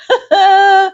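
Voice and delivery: short burst of high laughter. Real, not acted. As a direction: laugh out loud at yourself. Laughs at her own joke.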